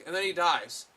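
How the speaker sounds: ordinary delivery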